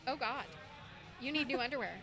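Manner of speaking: ordinary talk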